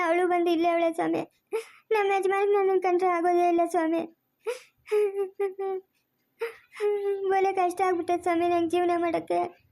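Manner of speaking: high, tearful sobbing complaint to the swami; crying plea. Acted. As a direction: weep out your troubles, voice breaking high. Woman pleads to swami, weeping. (ಅಳುತ್ತಾ ಸ್ವಾಮಿಗೆ ದುಃಖ ಹೇಳುವುದು)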